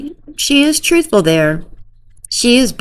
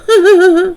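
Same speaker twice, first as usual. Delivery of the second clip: high hammed-up giggle; fake girly laugh; 4 dB louder than usual. Girl answering Nick's threat, fake high giggle. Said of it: "the fake laugh and cry thing".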